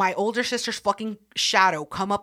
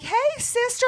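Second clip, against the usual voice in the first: much higher, sugary innocent greeting; sweet sister; 3 dB louder than usual. Retold or imitated. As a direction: play innocent, too sweet to trust. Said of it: "she's like".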